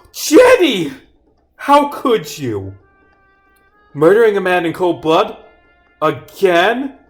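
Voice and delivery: hammed-up outraged yell; yelling accusation. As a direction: act shocked and accusing at full voice. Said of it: "I'm yelling at Jenny for murdering".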